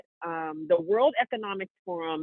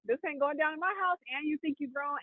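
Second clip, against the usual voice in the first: retold aunt scolding; 6 semitones higher, 7 dB quieter than usual. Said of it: "she one-two'd him".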